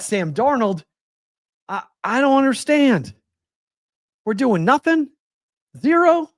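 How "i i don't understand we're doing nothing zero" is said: much higher, rising disbelief; can't believe they did nothing; incredulous disbelief. Real, not acted. Disbelief at no trade moves.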